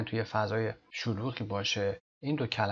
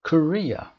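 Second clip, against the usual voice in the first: Korea with the RE syllable punched loud and high; stress on RE; 6 semitones higher, 7 dB louder than usual. Shown as a demonstration. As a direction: hit the second syllable of Korea. (تأکید روی ر در Korea)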